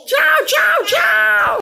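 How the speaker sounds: very high, giggly doll voice, nervous and excited; Barbie shrieking hello while laughing. Acted. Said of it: "A Barbie tá chegando!". (Barbie com voz aguda e risonha)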